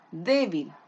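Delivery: DÉ in Débil leaned on; stress on first syllable. Shown as a demonstration. Teacher showing the tonic syllable, DÉ stressed in Débil. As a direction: hit the DÉ of Débil, let bil drop. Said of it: "¿Dónde se carga la voz? En D".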